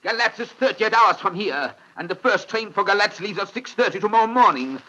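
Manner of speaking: high, tense alarm turning to despair; alarmed outburst. Acted. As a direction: blurt out the bad news in alarm. Alarmed realisation of defeat.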